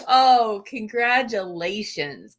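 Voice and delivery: ordinary delivery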